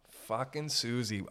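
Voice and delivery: usual speaking voice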